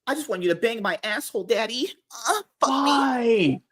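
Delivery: high, soft, whimpering put-on plea; hammed-up needy begging, slowing into a whine; mock pleading. Joking with co-hosts after a crude pun, mock pleading.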